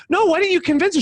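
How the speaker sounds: incredulous question. Retold or imitated. Teasing the friend over lunch, high and incredulous. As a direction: ask it high and disbelieving, half teasing.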